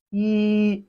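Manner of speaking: "yi" held long on one level high pitch; flat tone. Shown as a demonstration. Teacher models Chinese one for learners, flat-tone "yi". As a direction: sustain "yi" without any bend. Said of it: "It has a flat tone".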